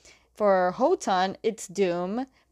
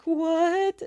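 freaked out; 9 semitones higher than usual. Retold or imitated. They're getting freaked out.

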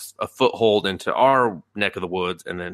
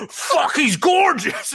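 a much higher, louder, slow squeal broken by laughter; swooning squeal; 5 dB louder, much slower than usual. Retold or imitated. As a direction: squeal like a smitten fangirl. Elf girl seeing his face.

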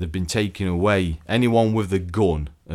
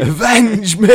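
high, hammy dying shout; overacted death scene; 14 semitones higher, 11 dB louder, slower than usual. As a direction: bellow it like a melodramatic dying hero. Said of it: "they go".

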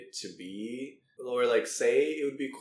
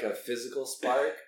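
usual speaking voice

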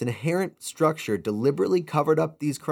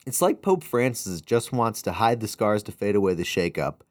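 usual speaking voice

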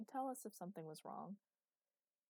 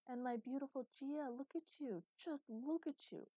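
gushing adoration; 7 semitones higher than usual. A narrator's reading. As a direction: fuss over a darling child. Fussing over a beloved child.

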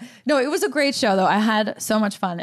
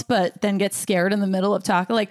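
ordinary delivery

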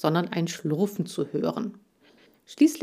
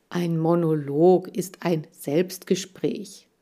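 ordinary delivery, nothing marked

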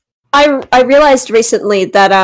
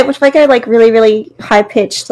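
ordinary talk

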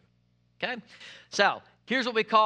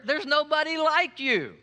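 normal talking voice

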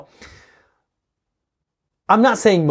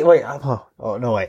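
ordinary talk

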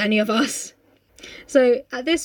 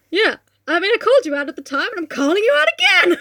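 mock call-out; 7 semitones higher, 5 dB louder, faster than usual. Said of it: "And it's like".

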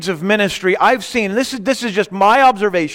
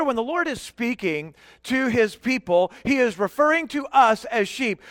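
ordinary talk

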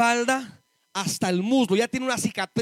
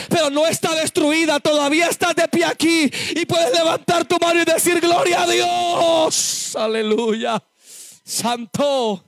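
preaching to praise; 3 semitones higher than usual. Acted. Preacher urging the congregation to praise God, rising to a shout. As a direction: lift them up until they shout glory.

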